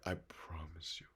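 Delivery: breathy whisper, almost to himself; whispered promise. Real, not acted. Whispering a promise to viewers who feel cold. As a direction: lean in and whisper it like a secret vow. Sincere aside to struggling viewers.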